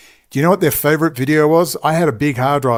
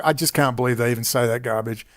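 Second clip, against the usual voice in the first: ordinary delivery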